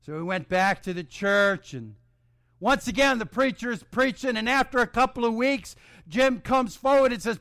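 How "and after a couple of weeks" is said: ordinary talk, nothing marked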